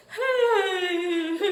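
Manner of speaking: laughing. Real, not acted. Laughing at her story.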